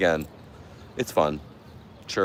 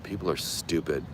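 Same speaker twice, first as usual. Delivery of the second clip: ordinary talk, nothing marked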